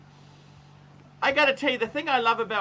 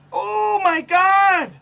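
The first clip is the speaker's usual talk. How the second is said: high, slow, loud exclamation; mock shock; 14 dB louder, much slower than usual. Host reacting to the guest's looks with a stunned cry.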